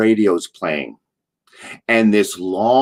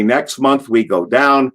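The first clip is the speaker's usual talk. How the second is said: ordinary talk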